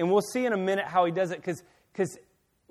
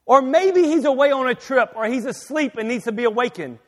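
mocking taunt, loud and high at first; jeer, easing off toward the end; scoffing; 4 semitones higher, 6 dB louder than usual. Elijah taunting prophets about their absent god, scoffing. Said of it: "he scoffed".

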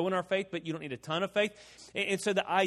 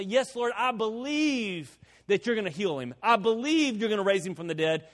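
emphatic, raised voice of eager faith; fervent declaration; 4 semitones higher, 4 dB louder than usual. Acted. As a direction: proclaim it hopefully, drawing out "believe". Rejected version of Martha's confession, acted.

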